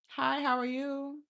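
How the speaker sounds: normal talking voice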